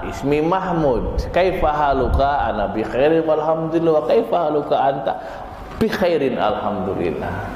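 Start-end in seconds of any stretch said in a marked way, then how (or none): none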